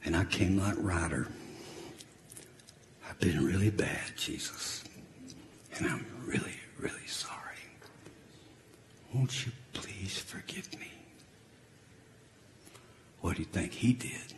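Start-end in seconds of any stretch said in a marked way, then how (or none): 3.1-4.8 whispered, broken plea; penitent whisper. Acted. Sinner whispering his apology to Jesus, penitent whisper. 5.8-7.4 unvoiced hushed; whispered sorry. Sinner praying to Jesus, whispered sorry. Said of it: "pair: whispered sorry". 9.1-10.9 whispered, broken plea; penitent whisper. Acted. Sinner whispering his apology to Jesus, penitent whisper.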